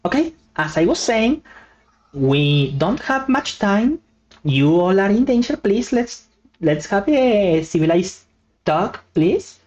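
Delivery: plead, a bit frantic; bunny pleading. Acted.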